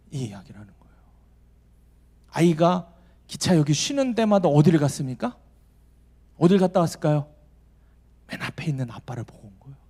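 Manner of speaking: ordinary talk, nothing marked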